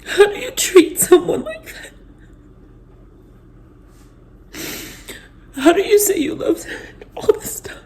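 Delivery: high, broken voice through tears; words cracking as she cries; crying. Real, not acted. To her viewers, sobbing in disbelief. Breaking down over betrayal.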